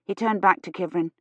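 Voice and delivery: ordinary delivery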